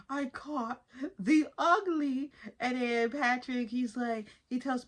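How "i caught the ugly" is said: slow, drawn-out lament; mock dismay. SpongeBob realising he caught the ugly, slow lament. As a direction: drag it out in mock horror.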